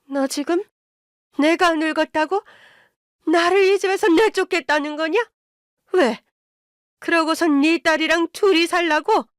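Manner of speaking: high-pitched outraged tirade; furious mother-in-law. A narrator's reading. Mother-in-law fears being thrown out, high furious questioning. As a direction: build from indignation to high fury. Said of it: "노발대발 화를 내더라고요".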